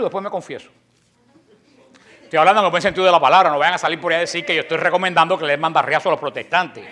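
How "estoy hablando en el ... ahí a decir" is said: louder and fast, rushing to clarify; hasty disclaimer. Real, not acted. Preacher to audience, hurrying a loud half-joking disclaimer. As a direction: blurt the correction out quickly. Quick disclaimer after a joke.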